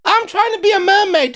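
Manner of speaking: mock high mermaid voice; silly mermaid voice. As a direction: ham it up like a wannabe mermaid. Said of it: "let's do an ad lib for it".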